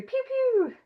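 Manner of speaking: high-pitched toy laser noise; playful pew-pew. Pretend. Tossing it over to Terry, playful high pew-pew. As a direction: squeak out little zapping sounds.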